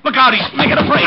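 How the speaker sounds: loud, high warning cry; urgent shout. Acted. Detective warning as the suspect flees, loud and high. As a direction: call out as he bolts.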